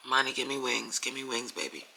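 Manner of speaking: hushed whisper, fading to almost nothing; whispered prayer. Real, not acted. Mother whispering a prayer to her daughter, hushed.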